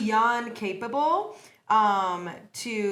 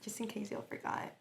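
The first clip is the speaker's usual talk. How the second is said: usual speaking voice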